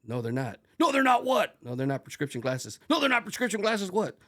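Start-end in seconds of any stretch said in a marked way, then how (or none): 0.8-1.6 very loud, high shouted challenge; imitation of an instructor bellowing; drill instructor yell. Instructor yelling back the cadet's answer. 2.9-4.2 high, loud shouted correction; demanding in-your-face shout; drill instructor yell. Retold or imitated. Instructor yelling back the cadet's answer.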